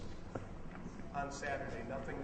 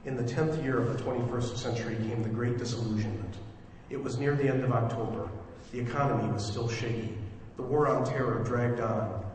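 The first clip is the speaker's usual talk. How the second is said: louder, lower-pitched radio narrator; story narration; 3 semitones lower, 12 dB louder than usual. Acted. Director opens the radio play for listeners, projected story narration. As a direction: deliver it as a measured, projected prologue. Reads the drama's opening narration.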